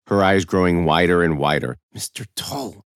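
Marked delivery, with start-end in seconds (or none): 1.9-2.9 hushed, higher-pitched scolding; hissed reproach. A narrator's reading. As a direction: hiss the name under your breath. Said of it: "Miss Goodnight hissed".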